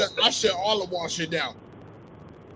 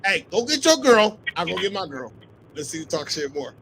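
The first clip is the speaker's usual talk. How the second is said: ordinary talk, nothing marked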